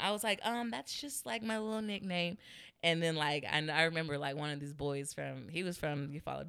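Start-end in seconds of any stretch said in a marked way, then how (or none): 0.5-2.4 higher, small embarrassed voice; sheepish explanation. Retold or imitated. Student explaining a nickname to the teacher, sheepish.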